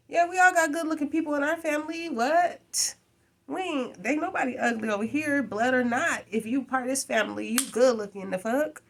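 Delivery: high, fast, playful family hype; mock excited high voice. To her boyfriend, mimicking a proud cousin, high and fast. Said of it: "I'm gonna be like".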